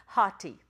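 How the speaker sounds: "hati" opening on an audible H; rough breathing. Shown as a demonstration. Teacher explains the Greek O, "hati" with an H in front. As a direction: start "hati" with a clear h, two syllables. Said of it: "it's got a rough breather".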